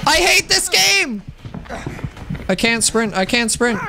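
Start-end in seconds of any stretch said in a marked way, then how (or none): 0.1-1.2 high, exasperated outburst; frustrated yell. Real, not acted. Player losing at the game, frustrated high yell.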